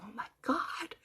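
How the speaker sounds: soft, half-whispered retold thought; thinking to herself. Mother to herself at a psychic reading, hushed thought. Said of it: "I'm thinking to myself".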